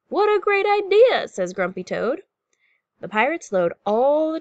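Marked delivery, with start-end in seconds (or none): 0.1-1.3 high, loud delight; bursting storybook enthusiasm; toad's excited agreement. A narrator's reading. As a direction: jump on the idea eagerly. Toad agrees eagerly in story.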